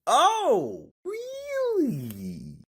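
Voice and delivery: very high, squealing delight, slowing down; gleeful surprise. Acted. The reader of Twitter squeals with delight at the news. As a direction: shriek it happily way up high.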